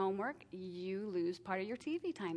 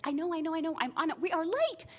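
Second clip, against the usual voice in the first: higher, louder, exasperated brush-off; impatient snap; 8 semitones higher, 6 dB louder than usual. Retold or imitated. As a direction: cut her off, you're late. Snapping at daughter on phone.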